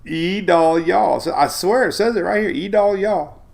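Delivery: high twangy E Dahl, y'all, laughing. Pretend. Laughing over the storm name, hammed-up twang. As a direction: keep hamming the hillbilly name. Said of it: "That's a redneck name".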